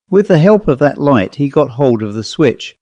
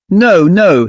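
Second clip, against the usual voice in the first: frightened, raised cry of no; a servant crying out in fear; terrified cry; 6 semitones higher, 4 dB louder than usual. A narrator's reading. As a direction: plead in terror, louder. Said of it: "he cried".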